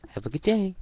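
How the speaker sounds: high, forced cheerful cashier voice; forced smile. Acted. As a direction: chirp it with a fake smile. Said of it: "She forced another smile".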